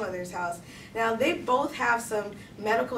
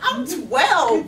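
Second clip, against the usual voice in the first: loud, higher mock outburst; exasperated cry; 4 semitones higher, 9 dB louder than usual. Twelve-year-old caller protests to operator, exasperated. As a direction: act out incredulous protest, blurted loudly.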